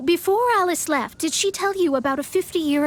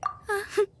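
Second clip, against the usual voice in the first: a quick amused laugh, softer; short laugh; 6 semitones higher, 6 dB quieter than usual. Acted. Mona pleased with her reading, short laugh. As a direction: chuckle at how easy it was.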